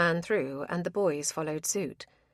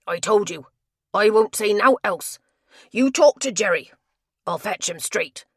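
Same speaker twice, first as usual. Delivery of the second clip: angry; 3 semitones higher, 7 dB louder than usual. Acted. Tapster refuses to say more.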